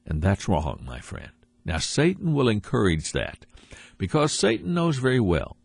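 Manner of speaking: ordinary talk, nothing marked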